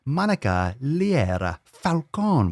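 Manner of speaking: slow, high, sweeping delivery; grand name announcement. Pretend. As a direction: stretch and relish every syllable with big swoops. Savouring the winner's name aloud.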